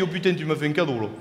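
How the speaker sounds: a touch of a low moved spectator; low, softened imitation of the guy; lightly voiced man. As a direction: audience member thanking the comic. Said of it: "il m'a dit".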